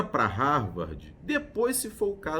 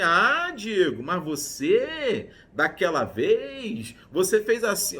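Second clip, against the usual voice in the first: drawn-out, raised complaining voice, slow; grudging complaint; 4 semitones higher, 5 dB louder, slower than usual. Retold or imitated. The friend reopening the issue with Diego, complaining slowly. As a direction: dredge up the old offence, whining a little. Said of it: "aí a pessoa vem".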